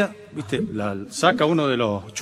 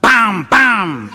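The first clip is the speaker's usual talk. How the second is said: gunshot noises; 4 semitones higher, 7 dB louder than usual. Retold or imitated. Acted gunshots.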